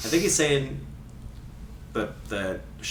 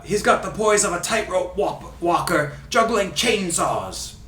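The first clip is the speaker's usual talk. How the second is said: put-on Ray Hudson Scottish accent; 3 semitones higher than usual. Host reading a free kick quote, in fake Scottish accent. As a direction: fake Scottish commentator, theatrical. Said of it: "doing his accent".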